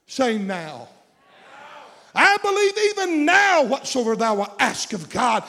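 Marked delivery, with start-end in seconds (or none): 2.2-5.4 Martha's faith. Retold or imitated. Martha quoted at full force.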